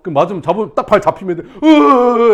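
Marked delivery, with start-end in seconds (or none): 1.4-2.3 high, very loud drawn-out "euu"; mock scream of panic; imitated terrified scream. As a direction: mimic a trainee grabbed by the leg. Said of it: "이렇게 된다고".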